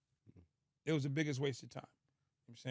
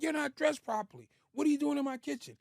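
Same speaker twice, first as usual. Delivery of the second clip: high-pitched mock yell; retold yelling; 10 semitones higher, 3 dB louder than usual. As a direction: imitate an indignant man yelling, pitched well up. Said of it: "He just starts yelling at me".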